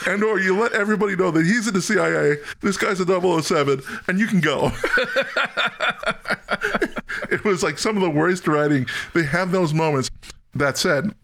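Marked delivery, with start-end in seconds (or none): 4.8-7.2 high, unvoiced laughing wheeze; rising breathy laugh. Real, not acted. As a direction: giggle into breath. Laughing at bad writing.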